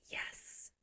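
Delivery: a breathless whispered yes of triumph. Retold or imitated. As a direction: hiss a tiny victorious yes. Quiet triumph at Arvel's win.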